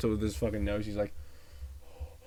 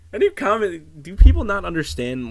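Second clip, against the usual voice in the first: incredulous rant; 8 semitones higher, 12 dB louder than usual. Real, not acted. Ranting at commenters.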